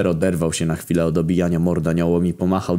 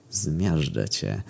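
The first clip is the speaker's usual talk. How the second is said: very slow, softer sneer; 6 dB quieter, much slower than usual. A narrator's reading. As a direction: savour the threat with a mocking smile. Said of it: "uśmiechnęła się szyderczo".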